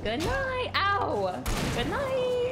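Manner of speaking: high, sweet goodnight; a lilting good night; singsong good night. Real, not acted. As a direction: sing the farewell to a friend. Farewell to a viewer.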